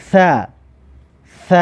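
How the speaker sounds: "ث" lisped as "tha"; tha with fatha. Shown as a demonstration. Teacher models Arabic tha for learners, "ث" as "tha". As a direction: say "ث" as "tha", tongue between teeth.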